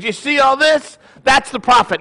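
high, punchy mock-lecturing; emphatic lesson. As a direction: land the punchline as the triumphant father. Joke father reaches the punchline.

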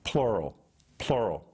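plural dropped low and firm; plural, plural, hammered home low; repeated low emphasis on plural; stressed plural. Shown as a demonstration. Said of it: "let down their nets, plural".

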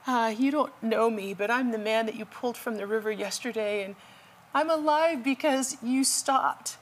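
lightly voiced weak old man; high, thin grateful caller. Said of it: "this weak voice".

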